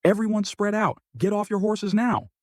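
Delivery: loud alarmed shout of command. A narrator's reading. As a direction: bark the order at the soldiers. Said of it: "becomes alert and shouts".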